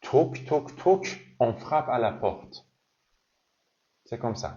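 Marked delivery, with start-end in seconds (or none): 0.0-1.3 high, sharp toc toc toc, rising at the end; French knock sound. Shown as a demonstration.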